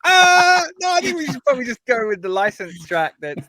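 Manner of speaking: hammed-up panic as you wriggle out of it; mock alarmed refusal. Acts out own alarmed refusal.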